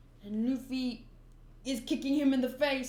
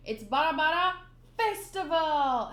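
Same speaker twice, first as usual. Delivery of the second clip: announced attack name; 4 semitones higher, 6 dB louder than usual. Shown as a demonstration. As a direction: proclaim Festival like a big attack. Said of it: "Oh, wait, wait".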